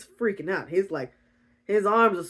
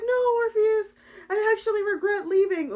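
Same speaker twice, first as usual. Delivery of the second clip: high, earnest pleading voice; hammed-up tender protest; pleading heroine; 14 semitones higher than usual. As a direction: reassure him in a high-pitched plea. Rhea pleads with hurt Orpheus.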